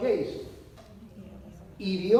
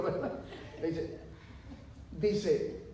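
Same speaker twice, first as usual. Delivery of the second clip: usual speaking voice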